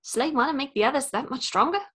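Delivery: lightly voiced New Zealand Korg; Korg accent. Friend guessing game, Korg in a relaxed New Zealand accent.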